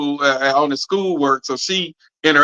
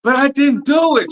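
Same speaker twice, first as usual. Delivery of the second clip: put-on much higher, pleading little boy; sad-faced kid; 10 semitones higher than usual. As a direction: put on the kid's sad puppy face voice. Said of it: "Trying to get the little sad face".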